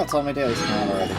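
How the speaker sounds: ordinary talk, nothing marked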